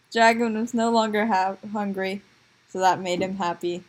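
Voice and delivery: plain reading voice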